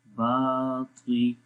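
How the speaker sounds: "बाती" slow, first vowel held long; long baa. Shown as a demonstration. Teacher repeats the elongation for learners, "बाती" with long "बा". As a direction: stretch "बा" before the "ती" of "बाती". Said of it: "बा को लंबा करेंगे".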